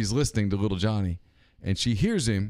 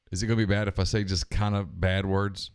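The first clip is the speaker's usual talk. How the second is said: ordinary delivery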